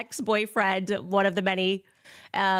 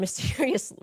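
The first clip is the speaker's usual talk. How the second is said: ordinary delivery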